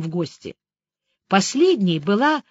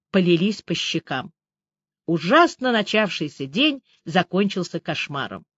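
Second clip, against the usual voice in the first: usual speaking voice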